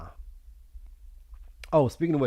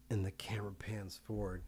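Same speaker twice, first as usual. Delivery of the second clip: whispering; 11 dB quieter than usual. Real, not acted. Hushed storytelling aside.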